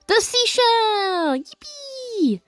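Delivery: high squeal of glee, drawn out; gleeful cheer. Real, not acted. Player about to visit the seashell, gleeful squeal.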